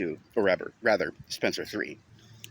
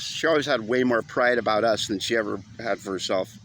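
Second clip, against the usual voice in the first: usual speaking voice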